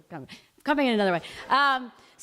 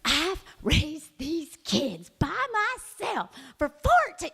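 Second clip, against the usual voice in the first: slow build to a high outburst on fourteen; mom laying into them; 5 semitones higher, slower than usual. Retold or imitated. Mom unloading her rage on her ex and his wife. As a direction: unload years of resentment, spike at the end. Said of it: "lays into them".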